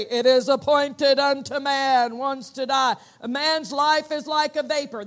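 loud, grand proclamation; dramatic booming voice. Retold or imitated. The voice foretelling his death, loud and dramatic. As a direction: boom it out like a verdict. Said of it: "it was so dramatic".

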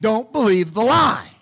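ordinary delivery

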